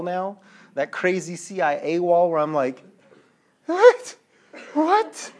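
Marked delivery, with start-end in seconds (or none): 3.7-5.3 high, loud incredulous outburst; exaggerated baffled yelp; acted disbelief. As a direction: play someone utterly baffled. Himself baffled before his crazy wall.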